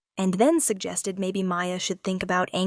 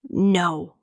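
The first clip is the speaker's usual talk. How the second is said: mildly sharp refusal; too sharp, quick. A narrator's reading. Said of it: "The word came out too sharp, too quick".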